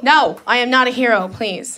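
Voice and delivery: usual speaking voice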